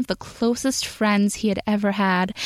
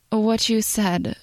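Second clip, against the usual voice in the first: slow, halting words; slow and hesitant; slower than usual. A narrator's reading. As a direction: drag it out hesitantly. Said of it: "he said slowly, hesitantly".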